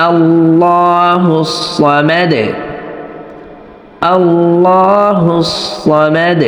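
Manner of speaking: slow recitation stopping on the final dal; pause-form recitation. Shown as a demonstration. Teacher demonstrating the waqf stop, slow recitation. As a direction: chant it slowly and stop on Samad with no ending vowel. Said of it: "শুধুমাত্র দালের উপরে ওয়াকফ করলেই".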